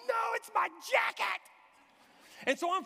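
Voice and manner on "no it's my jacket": high, loud hammed-up holler; shouted protest. Man to the crowd over his jacket, shouting back.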